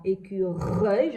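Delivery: "écureuil" slow and loud, with a long R; teacher sounding out the R of "écureuil"; emphasized R. Shown as a demonstration. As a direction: stretch the R in "écureuil". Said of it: "j'entends bien le R".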